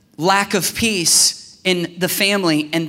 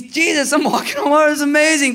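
higher, thrilled voice of Peter; 8 semitones higher, 3 dB louder, faster than usual. Retold or imitated. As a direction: play Peter giddy on the waves.

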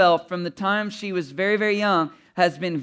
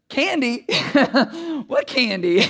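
mock-innocent, breaking into laughs; feigned innocence; 6 semitones higher, slower than usual. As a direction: play dumb about the candy, laughing. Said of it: "I pull this".

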